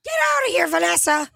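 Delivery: very high squeal; squeaky cartoon voice. Friend chiming in to banish Vanessa, tiny high squeak. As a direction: shoo her off in a tiny shrill cartoon voice.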